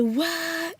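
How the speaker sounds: high-pitched mock "what"; surprised students. Students hearing teacher can switch her accent, mock surprise. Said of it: "And it was like".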